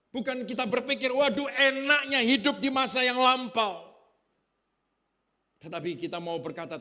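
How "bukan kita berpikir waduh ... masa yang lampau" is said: full-force preaching. Acted. Pastor to his congregation about being planted, preaching at full force. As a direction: pound out each phrase to the congregation.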